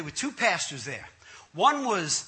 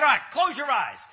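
imitated preacher command; 5 semitones higher, 4 dB louder than usual. Pastor giving orders before prayer.

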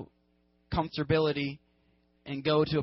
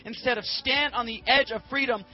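freaking out; 3 semitones higher than usual. Acted. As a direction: rant at Moses in mounting indignation. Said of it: "they freak out at him".

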